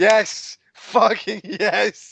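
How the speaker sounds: drawn-out cheer. Real, not acted. Excited cheer.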